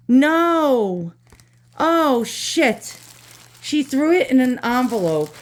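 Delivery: sudden high cry of dismay; high, horrified no; dismayed shock. Real, not acted. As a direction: react in real shock at the damage. Discovers item badly packed.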